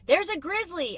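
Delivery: loud call to the crowd; shouted line. A narrator's reading. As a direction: call it out like an excited spectator. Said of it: "a man shouted".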